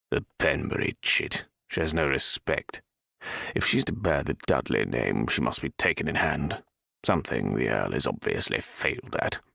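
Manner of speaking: low, scornful aristocrat; stern old lord. A narrator's reading. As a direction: look down on her with contempt. Viscount scorning Jenna.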